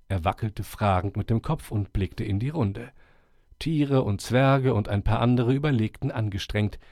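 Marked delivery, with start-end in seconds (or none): none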